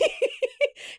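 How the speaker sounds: very high giggling burst; real laughter. Real, not acted. Host cracking up at Danielle's red rum fear, real laughter. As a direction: break down laughing mid-quote.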